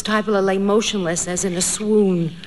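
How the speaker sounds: ordinary delivery, nothing marked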